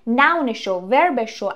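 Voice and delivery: usual speaking voice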